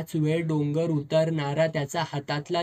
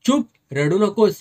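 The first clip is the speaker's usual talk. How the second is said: loud, higher barked command; shouting; 4 semitones higher, 8 dB louder than usual. A narrator's reading. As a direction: shout her into silence. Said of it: "साहेब ओरडला".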